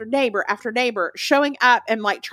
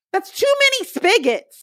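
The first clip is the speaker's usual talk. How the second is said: exasperated; 11 semitones higher than usual. Real, not acted. Exasperated about house.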